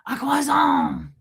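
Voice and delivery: high, slow, over-the-top French croissant; full-out French accent. Shown as a demonstration. Host showing how to overdo it, full-out French accent. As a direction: go extra cringe with the French accent. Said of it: "at least go full out with the".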